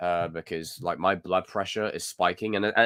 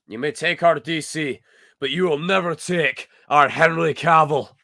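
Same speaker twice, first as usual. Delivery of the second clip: high, loud rallying speech, slowing as it builds; mock battle cry; 6 semitones higher, 5 dB louder than usual. [name] rallying fans over Henry Cavill, mock battle cry. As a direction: build to a drawn-out defiant war cry.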